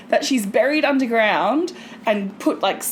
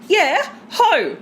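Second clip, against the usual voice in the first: higher, certain mum; imitating mum; 8 semitones higher, 4 dB louder than usual. Mum confirming the wrong name, higher. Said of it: "I'm like".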